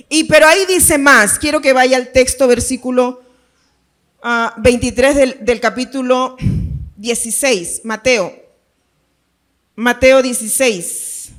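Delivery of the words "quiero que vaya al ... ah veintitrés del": ordinary delivery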